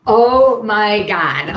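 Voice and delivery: loud slow oh my God; drawn-out loud groan of disbelief; mock exasperation, loud and slow; exasperated. Real, not acted. Reacting to poll.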